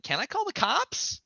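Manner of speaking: small and nervous; timid asking. Retold or imitated. Him asking the armed man, meek and high. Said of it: "and I asked".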